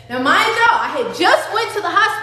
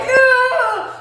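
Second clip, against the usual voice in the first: loud high groan of pain; pained cry; 9 semitones higher, 5 dB louder than usual. Retold or imitated. Mother in labor pain, loud high cry.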